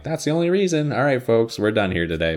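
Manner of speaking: high, loud sign-off; a hammed-up host ending the show; mock show host.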